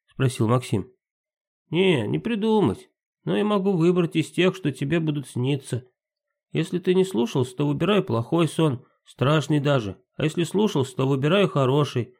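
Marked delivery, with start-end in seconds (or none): 1.7-12.1 pixie. Acted. Timka explains dreams.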